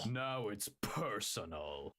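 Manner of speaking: slow, low action-hero line; blonde American hero voice. Acted. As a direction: drag it out like a movie hero. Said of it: "You do it better than me afterwards".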